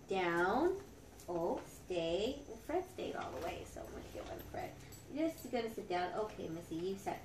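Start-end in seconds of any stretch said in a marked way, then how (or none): 2.5-4.7 hushed whisper to herself while treating the dogs. Real, not acted. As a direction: breathe it out fast so the dogs stay put. Quietly giving dogs treats.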